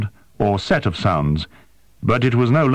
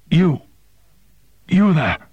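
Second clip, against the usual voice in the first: gasping call; 8 semitones higher than usual. A narrator's reading. Dying creature on the wall gasps at Yuejin, straining. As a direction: force the words out between gasps. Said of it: "gasped the creature on the wall".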